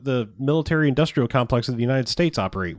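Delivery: ordinary delivery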